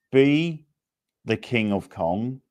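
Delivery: ordinary talk, nothing marked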